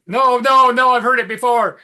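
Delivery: loud, high-pitched imitation of a fed-up wife; mock exasperated protest. Wife stops husband retelling myths, mock exasperation. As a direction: cut off the storyteller with hammed-up protest. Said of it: "she is so tired of me telling these myths".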